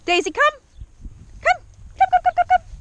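high, loud, urging recall; calling the dog. Real, not acted. Trainer calling Daisy in, high and urgent. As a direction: call the dog in with rapid high repeats.

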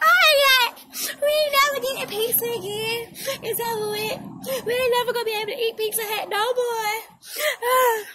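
high, loud mock-crying lament; dramatic wailing. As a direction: wail it like a heartbroken kid. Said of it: "that being all dramatic".

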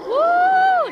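high, loud, drawn-out whoop; cheering "woo". Real, not acted. Cheering a hit.

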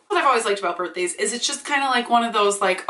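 normal talking voice

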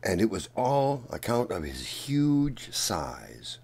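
ordinary delivery

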